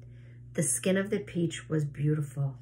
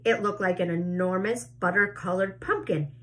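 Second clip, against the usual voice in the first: ordinary delivery, nothing marked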